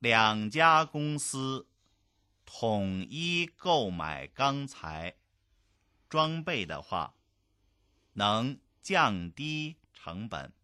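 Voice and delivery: very slow, phrase by phrase; slow model reading. Shown as a demonstration. Language teacher reading a business dialogue for learners, slow and segmented. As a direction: stretch each word out, pausing between phrases.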